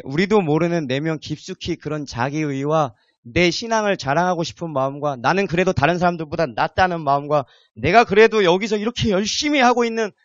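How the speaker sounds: ordinary talk, nothing marked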